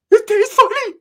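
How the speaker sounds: high-pitched screaming character, very loud; Ricky's outraged shriek. Acted. Ricky screaming after the fight.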